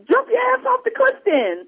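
very high-pitched mock scolding; retold angry retort. Angry neighbor turning him down, imitated high and sassy. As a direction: imitate her dismissal in a high voice.

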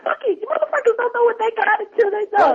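high-pitched mock outburst; retold outburst. Friend quoting the movie's angry neighbor, high mock outburst. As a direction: throw it out high, imitating a fed-up woman.

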